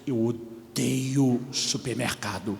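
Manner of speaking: very slow, dragged-out horrified groan; mock horror, drawn out; face of terror. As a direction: say it like a man in dread. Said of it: "faz uma cara de terror e diz".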